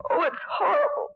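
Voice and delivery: horrified cry. Acted. Horror at the dead woman.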